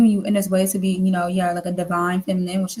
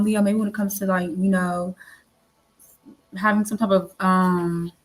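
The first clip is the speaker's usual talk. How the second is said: ordinary delivery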